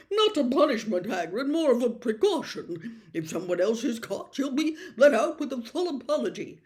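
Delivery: a minister smoothing it over, hurried at the end; placating Fudge. Acted.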